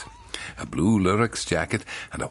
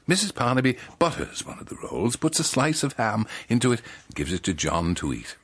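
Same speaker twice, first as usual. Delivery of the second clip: ordinary talk, nothing marked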